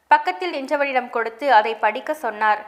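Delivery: ordinary delivery, nothing marked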